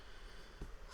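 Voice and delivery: long heavy exasperated sigh; fed-up sigh. Real, not acted. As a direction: let out a weary breath of disgust. Fed-up rant about the game.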